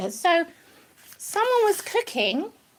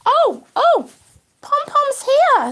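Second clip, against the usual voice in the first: higher, louder, slow delighted cry; excited surprise; 8 semitones higher, 7 dB louder, much slower than usual. Real, not acted. Owner spots the other rabbit arriving, delighted. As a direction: greet the arriving bunny with joy.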